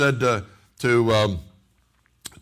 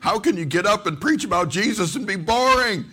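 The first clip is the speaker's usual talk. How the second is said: high-pitched indignant protest; retold exasperation, voice pitched up; upset; 7 semitones higher than usual. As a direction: protest it as an upset young listener. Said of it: "I was upset".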